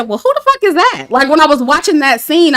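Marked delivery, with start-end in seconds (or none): 0.0-1.1 high, startled question; mock shock. Reacting to the singer barging in, high startled question. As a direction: ask it like the intruder startled you. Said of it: "I said".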